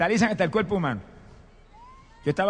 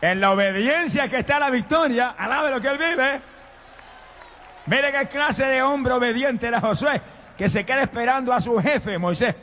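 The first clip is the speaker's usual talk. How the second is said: high, emphatic pulpit delivery; impassioned sermon exhortation; fervent preaching; 4 semitones higher than usual. Real, not acted. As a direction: drive the point home to the congregation, pitched up. Preaches at raised pitch.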